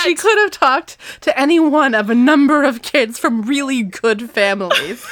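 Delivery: hammed-up haughty rich girl; mock snobbish outrage. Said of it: "mocking Courtney".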